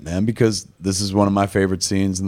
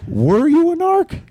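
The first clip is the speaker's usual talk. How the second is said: high-pitched, drawn-out shocked question; mock alarm. Startled smoker confronts the supposed narc, mock alarmed. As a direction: imitate startled disbelief, voice shooting up.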